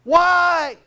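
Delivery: high, strained wail of grief and protest; loud, drawn-out, high-pitched cry of protest; anguished outcry. Retold or imitated. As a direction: cry out to God in bitter anguish. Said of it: "do you know what my response was".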